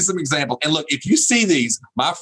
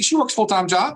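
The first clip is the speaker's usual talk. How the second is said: ordinary talk, nothing marked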